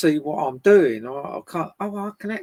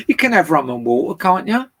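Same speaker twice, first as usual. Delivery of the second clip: loud teasing; 2 semitones higher, 9 dB louder than usual. Real, not acted. Teasing Tim loudly.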